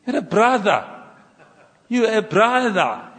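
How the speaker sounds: high, loud, slowing down on the repeat; emphatic insistence. Real, not acted. Insisting to title-proud pastors, emphatic and slowing.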